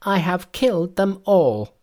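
high-pitched, amused monkey voice; high Monkey voice, a touch of mirth; gleeful Monkey. A narrator's reading. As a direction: announce it high and triumphant.

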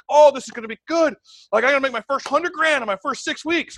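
high, loud, pumped-up bragging; super stoked. Retold or imitated. Recruit expecting big money from his guests, super stoked. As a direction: crow it out with big excitement. Reliving his excitement at the meeting.

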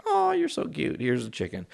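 put-on very high cooing baby talk; baby talk to cat. Owner cooing at his cat doing the bear, high baby talk. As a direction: coo at a cute pet. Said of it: "It's like".

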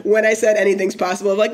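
ordinary delivery

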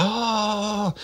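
very high falsetto mock wail; long hammed-up howl of dismay; imitating wailing suspect. Suspect told she may be executed, imitated high wail. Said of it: "And she was like".

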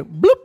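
quick popping "blup" noise; imitated plop. Woman describes a toy going in, imitated plop. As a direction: make a comic plop sound. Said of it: "era como que".

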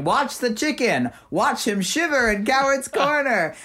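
high, theatrical announcer voice; hammed-up horror announcer; spooky announcer recording. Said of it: "a recording was going".

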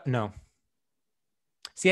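usual speaking voice

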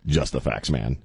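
low deadpan TV detective; Joe Friday. Acted. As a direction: drop low, matter-of-fact cop. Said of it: "pitch-perfect imitation of him".

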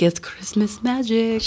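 slow, drawn-out magical reveal; lightly hammed-up festive wonder; playful wonder. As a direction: stretch it out with mock mystery. Playful answer to the grateful mom.

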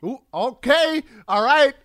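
big loud reaction, stretching the okay; playful excitement. Real, not acted. Host egging on a panelist who claims it's easy, loud and excited.

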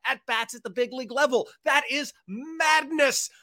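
excited. Real, not acted. Disbelief at the stat.

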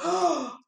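sharp high gasp, showing a lot of surprise; big surprised gasp. Shown as a demonstration. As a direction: gasp as if truly shocked, for a child to copy. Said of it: "a lot surprised".

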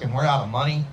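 ordinary talk, nothing marked

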